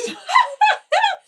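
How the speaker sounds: shrieking laugh; real squeal of a laugh; a loud high giggle; high burst of laughter. Real, not acted. Laughs at kid's photo.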